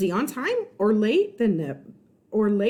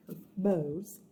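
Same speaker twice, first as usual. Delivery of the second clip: plain reading voice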